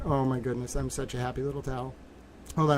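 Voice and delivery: ordinary delivery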